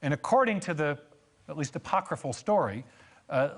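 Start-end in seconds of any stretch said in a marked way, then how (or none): none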